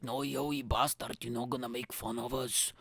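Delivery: put-on Scottish accent of scolding gods; mock Scottish reprimand. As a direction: play an offended Scottish god. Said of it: "the Scottish gods are like".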